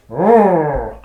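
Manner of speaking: loud mock roar, words shouted out; a big hammed-up battle roar. Warhound Ember roaring at the goblin after being stabbed, loud mock roar. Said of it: "he's gonna go".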